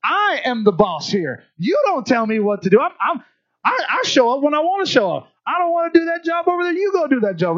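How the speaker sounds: strut it like a spoiled tyrant at work; spoiled boss. Retold or imitated. Spoiled adult bosses coworkers around, a high hammed-up arrogant rant.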